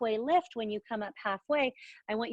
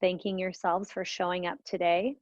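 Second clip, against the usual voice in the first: guided meditation; 2 semitones lower than usual. Acted. Leads a guided breathing meditation.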